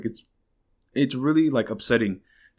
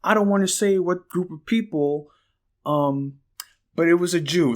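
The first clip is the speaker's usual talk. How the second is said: higher, put-on voice of a rapper; mock the celebrity, pitched up; 6 semitones higher than usual.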